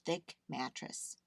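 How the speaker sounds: ordinary delivery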